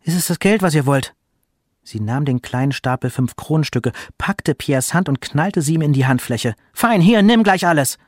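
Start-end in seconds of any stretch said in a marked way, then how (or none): 0.0-1.1 higher, challenging question; losing her temper. A narrator's reading. Mother confronts her sons about money, losing her temper. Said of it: "die Mutter plötzlich ausrastet". 6.7-8.0 much higher, louder angry outburst; losing her temper. A narrator's reading. Mother slams coins into son's hand, losing her temper. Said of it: "die Mutter plötzlich ausrastet".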